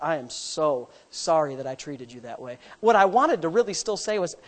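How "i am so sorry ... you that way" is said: sincere apology. Retold or imitated. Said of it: "I said".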